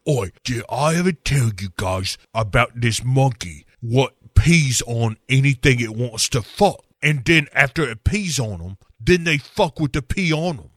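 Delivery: put-on cockney girl chatter; mock cockney. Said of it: "[name] here chimes in".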